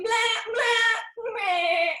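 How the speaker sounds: loud, high bleating; goat bleat. Acted. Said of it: "Goat's not taking this well".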